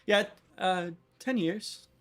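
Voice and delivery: Sarah. Acted. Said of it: "Sarah, how long have you been married".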